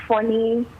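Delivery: normal talking voice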